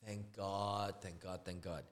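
very soft, low sigh of thanks; hushed relief. Retold or imitated. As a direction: murmur it over and over in relief. Relieved that painter can come.

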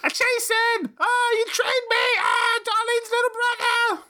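put-on very high, slow squealing drunk fan voice; screaming lady role play. Friend role-plays the screaming lady to provoke him, very high squeal. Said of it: "I'm the screaming lady".

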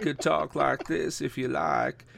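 soft Southern politician drawl; Bill Clinton. Acted. Cohost offers to talk like this, as a drawling ex-president. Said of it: "is Bill Clinton one of the legends?".

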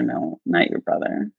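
hushed no, almost breathless; whispered refusal. Retold or imitated. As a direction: drop to a whisper. Turning down pastor's brother.

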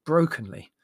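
plain reading voice

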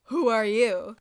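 loud, booming acted demand; dramatic retold challenge. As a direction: call it out forcefully like a confronting officer. Voicing Amon challenging the hooded figure.

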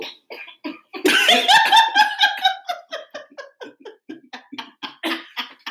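long, very high laughing fit; high laughter. Real, not acted. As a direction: burst out in squealing laughter, letting it run. Long outburst after talk of love.

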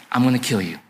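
low, understated menace; acted cold vow of revenge; menacing threat. Esau repeats his death threat.

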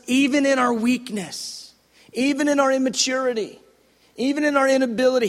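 a fast, ringing pulpit cry; preaching shout. Real, not acted. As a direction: drive the last phrase home with full force. Sermon climax, rising emphasis.

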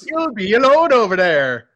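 a light Swedish Chef imitation; mock Swedish lilt. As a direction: echo the line with a hint of Swedish Chef.

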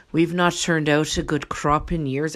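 second go at an Irish lilt; attempted Irish accent. Comic retry as famine ancestor, Irish accent. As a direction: say it in a put-on Irish brogue. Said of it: "They're Irish. Hold on".

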